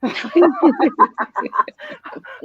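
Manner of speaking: high wheezy laugh; breathless laugh. Real, not acted. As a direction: laugh while gasping for air.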